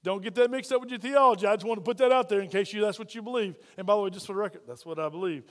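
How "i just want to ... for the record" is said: ordinary talk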